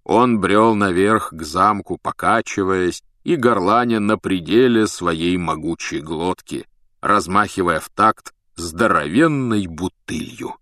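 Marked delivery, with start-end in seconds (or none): none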